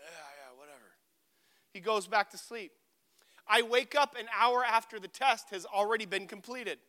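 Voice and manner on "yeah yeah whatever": very soft, low sleepy mumble; imitating half-asleep. Half-asleep roommate brushing off a request, sleepy mumble. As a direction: mumble it as if barely awake. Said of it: "Isaiah was half asleep".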